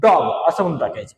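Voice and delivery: डॉग said with an open aw vowel, low; pronunciation demo. Shown as a demonstration. Teacher shows students how डॉग sounds, careful demo. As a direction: model डॉग as dog, rounded open o. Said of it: "याला ड-, डॉ करून टाकायचं".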